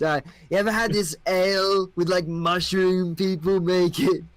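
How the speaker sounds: a friendly king who took something; young king. Acted. King welcoming the adventurers, high and loose.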